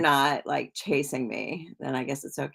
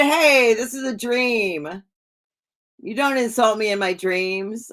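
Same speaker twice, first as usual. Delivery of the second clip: raised, higher-pitched retold protest; playfully indignant, voice pitched up; mock indignation; 5 semitones higher, 4 dB louder than usual. Streamer retelling telling off someone in their dream, mock indignant. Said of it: "I got mad in my dream".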